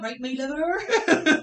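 put-on loud, drawn-out Cornish accent; hammed-up Cornish greeting, high and laughing; weird Cornish kobold. Kobold greeting the party, exaggerated Cornish voice. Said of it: "This is such a weird kobold voice".

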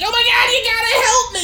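high, loud, crying plea for help; panicked plea. Retold or imitated. Lori begging Buddy to help her, high panicked cry. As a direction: beg like she's running from a killer.

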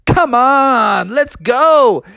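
high, urging cartoon hero; eager drawn-out rallying call; Sonic. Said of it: "And Sonic was like".

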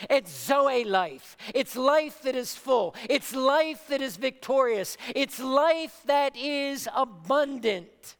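building preacher's refrain; emphatic preaching. Acted. Preacher telling the congregation about abundant life, emphatic preaching. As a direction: preach it in ringing, repeated phrases.

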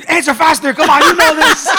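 high, loud, laughing mock heckle; mock impatient dad. Dads in the car urging the wives, loud mock heckling. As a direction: play the impatient dads yelling at the car radio.

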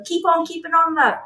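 put-on high, cheery Welsh woman's accent. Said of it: "[name]'s Welsh accent".

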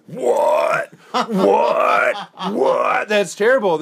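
high, loud repeated what; hammed-up outrage from a bar owner; mock horrified disbelief. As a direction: act out the owner's shock. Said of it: "He's like".

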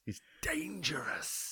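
slow hushed whisper; slow spooky whispered tease; mock whisper. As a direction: whisper it like a scary secret.

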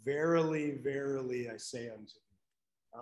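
Verily, verily, I say unto you read soft and low, fading away; fading archaic quote; hushed King James reading. Retold or imitated. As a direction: quote the old King James words quietly, almost to yourself. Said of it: "I like the King James Version".

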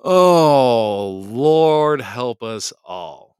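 loud, long-drawn comic wail; mock lament. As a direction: draw it out loudly in playful despair. Mock lament after laughing.

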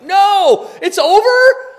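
high, loud cry of disbelief; a loud stunned no; shocked disbelief. Retold or imitated. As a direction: gasp it out like time just ran out. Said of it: "you're like".

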